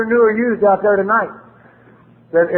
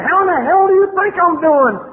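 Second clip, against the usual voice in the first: retold furious outburst, pitched way up; angry retort; 11 semitones higher than usual. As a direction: fire the question back at him high and strained. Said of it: "and I told him".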